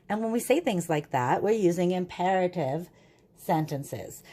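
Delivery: normal talking voice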